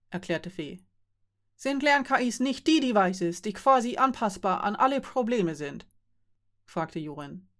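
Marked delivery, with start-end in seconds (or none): 1.6-5.9 somewhat annoyed. A narrator's reading. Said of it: "Nun war As tatsächlich etwas verärgert".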